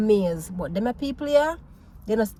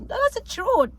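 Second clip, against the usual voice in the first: high emphatic insistence; 13 semitones higher, slower than usual. Real, not acted. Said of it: "repeats the phrase, much higher".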